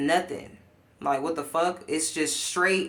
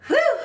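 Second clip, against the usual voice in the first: loud high-pitched "whew" of relief; 9 dB louder than usual. Real, not acted. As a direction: let out a big, loud high whoop. Exhales after a rant.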